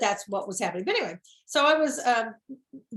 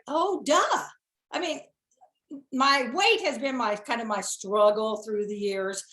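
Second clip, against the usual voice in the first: ordinary delivery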